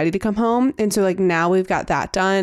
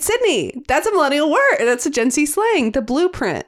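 excited exclaim; 7 semitones higher than usual. Retold or imitated. Retelling her own excited exclaim.

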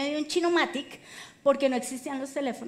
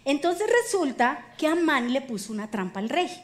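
ordinary talk, nothing marked

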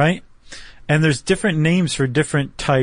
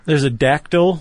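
ordinary talk, nothing marked

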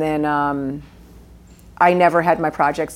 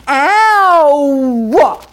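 long, much higher and louder Queens-style ow; Silvana's Queens ow; 10 semitones higher, 8 dB louder than usual. Retold or imitated. Actress showing the neighbor's Queens ow, loud and drawn out. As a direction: stretch ow into a loud Queens wail. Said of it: "Say ow like Silvana, my neighbor".

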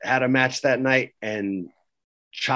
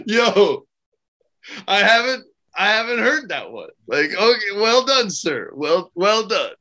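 high-pitched, impressed disbelief, re-enacted; mock-stunned congratulations, pitched well up; retold amazement; 8 semitones higher than usual. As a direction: act out being floored by the story. Retold amazed reaction to dad story.

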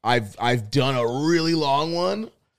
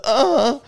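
high, loud uh; sudden raised yelp; imitated tic noise; 12 semitones higher, 5 dB louder than usual. Shown as a demonstration. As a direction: blurt out the tic sound. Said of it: "where you did the".

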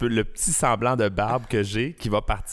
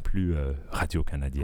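voix radio grave; 9 semitones lower than usual. Shown as a demonstration. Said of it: "Moi, j'ai la voix plus grave".

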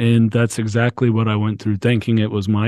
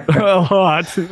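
laughing it off, pitched up; a real nervous laugh through the words; rueful laugh; 8 semitones higher than usual. Real, not acted. Laughs about drinking a lot.

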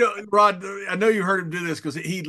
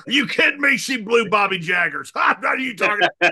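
higher, louder incredulous shout; mock outrage; 3 semitones higher, 5 dB louder than usual. Ack shouting back at Bart to wind him up. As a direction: blurt it out in loud disbelief. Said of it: "And Akbar goes".

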